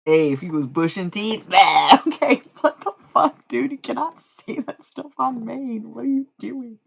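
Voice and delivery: high, loud, laughing shock at chat; loud mock outrage at a viewer's joke; shocked outburst. Real, not acted. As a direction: blurt it out, scandalised. Shocked at chat's comment.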